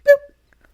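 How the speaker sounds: high, loud cartoon pop; popping boop. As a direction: imitate a lid popping off. Imitates the tomato top popping.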